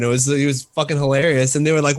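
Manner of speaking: ordinary talk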